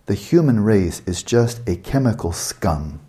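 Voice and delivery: slow, deliberate reading of the quote; slowly. Shown as a demonstration. Lecturer rereading Hawking to the audience, slowly. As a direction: drag out every word so it lands. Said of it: "I have to say this slowly".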